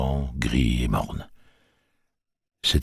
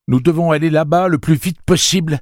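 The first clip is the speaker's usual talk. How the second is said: voix très haute et forte, pressante; urgence; 14 semitones higher, 9 dB louder than usual. Acted. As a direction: exige tout de suite. Eddie pressant Roland. (Eddie presse Roland, très agité)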